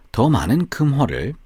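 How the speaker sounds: usual speaking voice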